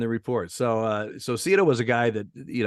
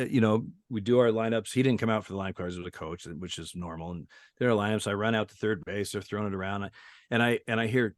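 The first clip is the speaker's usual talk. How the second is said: ordinary talk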